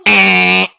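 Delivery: loud, held buzzer noise; imitated harsh error buzz; wrong-answer buzzer. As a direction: blare it like a game show buzzer.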